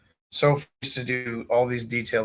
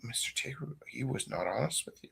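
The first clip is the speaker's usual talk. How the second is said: much softer, hushed tattletale; tattling aside; 13 dB quieter than usual. Retold or imitated. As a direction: snitch quietly to the teacher. Classmate tattles on Mike.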